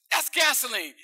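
loud, high disgusted cry; disgusted outburst. Acted. Young doctor after his sip, loud disgusted cry. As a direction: blurt it out, repulsed.

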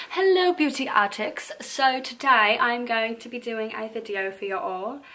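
hammed-up British-Australian accent; playful mock British-Australian greeting, drawn out at the end. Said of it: "a cross between a British, Australian".

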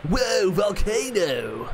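slow drawn-out surfer dude call. Acted. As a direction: stretch it out like a stoked surfer. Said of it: "say the name in a loud surfer voice".

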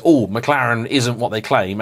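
ordinary talk

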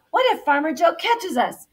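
high, loud, anxious question; worried yelling. A narrator's reading. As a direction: yell it out in storybook worry. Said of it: "Wing yelled".

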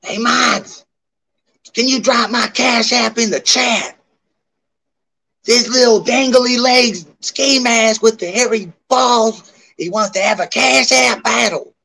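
brassy gum smacking floozy; high, loud, brassy floozy voice; Michelle voice. Retold or imitated. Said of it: "uh, Michelle voice".

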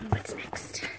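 soft whispered aside to herself. Real, not acted. Unboxing books on camera, she whispers. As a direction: breathe the words out, no voice.

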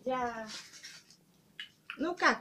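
ordinary delivery

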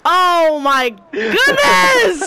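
drawn-out anguished cry; dramatic wail. Real, not acted. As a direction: wail it like a villain. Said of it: "like a Disney villain having a mental".

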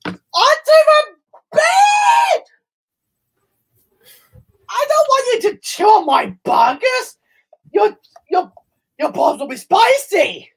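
mock sobbing yell at the dog over burgers; mock crying yell. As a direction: wail it like a fake tantrum. Hammed wailing at the dog.

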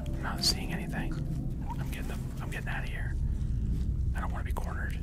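tense, soft whisper. Real, not acted. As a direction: whisper so the monsters won't hear. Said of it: "back to whispering".

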